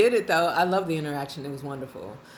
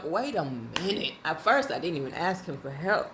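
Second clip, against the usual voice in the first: ordinary delivery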